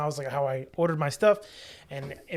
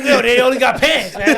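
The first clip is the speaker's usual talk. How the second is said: laughing dad quote; 7 semitones higher, 10 dB louder than usual. Retold or imitated. Voicing dad's insistence, laughing.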